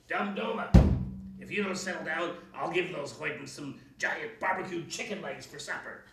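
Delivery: loud bellow; witch bellowing. A narrator's reading. Witch bellowing at her house Dumduma. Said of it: "bellowed the witch".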